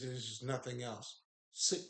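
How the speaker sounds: usual speaking voice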